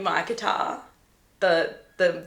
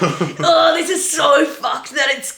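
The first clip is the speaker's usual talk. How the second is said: loud, higher, laughing mock yell; gleeful shout; 6 semitones higher, 7 dB louder, slower than usual. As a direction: yell it with giddy relief at the bomb. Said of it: "like, shouting".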